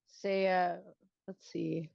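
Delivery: usual speaking voice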